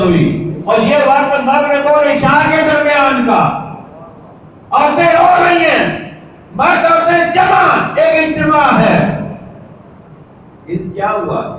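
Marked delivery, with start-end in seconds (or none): 4.7-9.2 raised sermon cadence; preaching at full force. Acted. Preacher painting the mourning scene for listeners, raised and fervent. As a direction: call it out over the crowd.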